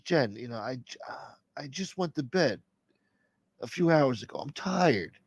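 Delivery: soft, hushed tired reply; sleepy mumble. Pretend. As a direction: mumble like a half-asleep husband. Sleepy [name] answers [name].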